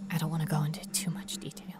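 rushed hushed whisper; fast secret whisper; quick whisper. Acted. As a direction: hurry it out under your breath.

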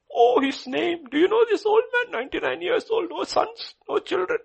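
very high, loud sneering jeer; mocking crowd. Gossiping neighbours jeering at childless old Abraham, shrill mockery. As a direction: scoff at the old man.

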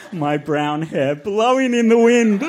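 high, rising hammed-up reading; melodramatic narration. A narrator's reading. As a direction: build to an overblown high climax. Reader hams up a dramatic moment.

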